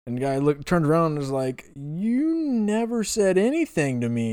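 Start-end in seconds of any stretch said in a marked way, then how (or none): 1.8-4.3 slow, high-pitched protest; retold indignant retort. Retells electrician's indignant answer.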